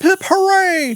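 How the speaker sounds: usual speaking voice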